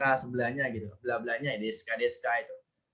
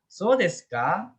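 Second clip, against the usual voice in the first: slow, higher "So desu ka", lifting on "ka"; rising question intonation; 5 semitones higher, 4 dB louder, slower than usual. Shown as a demonstration. Teacher warning that rising means asking, "So desu ka" going up. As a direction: turn "So desu ka" into a question by raising "ka". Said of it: "itu nanya kan kalau dia naik".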